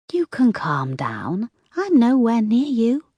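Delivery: slow then high and taunting; creepy caller. A narrator's reading. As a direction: toy with her calmly.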